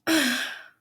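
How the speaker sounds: loud shaky sigh after crying; heavy tearful sigh. Real, not acted. Reader sighing loudly, still upset after crying. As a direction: let out a big sigh through tears. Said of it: "crying on a voice recorder".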